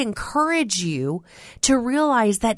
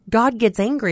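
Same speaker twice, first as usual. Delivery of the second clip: normal talking voice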